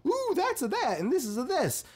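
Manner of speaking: high, thrilled fanboy voice; excited fan. Retold or imitated. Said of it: "You were just like".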